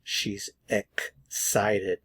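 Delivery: "She's excited" slowly, pausing after "She's" and after ek in "excited"; syllable by syllable. Shown as a demonstration. Teacher shows the pronunciation of "She's excited", slow and in pieces. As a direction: break "excited" as ek, pause, sited. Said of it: "Notice the pronunciation".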